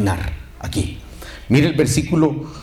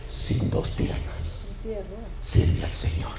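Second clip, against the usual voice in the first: slow, softened almost to breath; hushed solemnity; 7 semitones higher, 9 dB quieter, slower than usual. Real, not acted. Preacher closing a testimony about an amputee, hushed. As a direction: drop to a hush and let it sink in.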